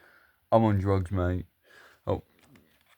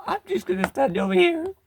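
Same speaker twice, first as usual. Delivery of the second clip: high character voice. Acted. Toy character speaks.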